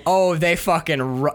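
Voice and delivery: normal talking voice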